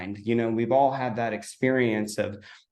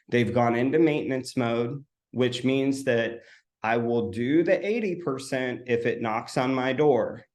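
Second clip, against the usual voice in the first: normal talking voice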